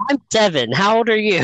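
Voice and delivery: put-on high little-kid voice asking a friendly question; innocent kid. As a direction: sound like a curious seven-year-old. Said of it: "innocent kid".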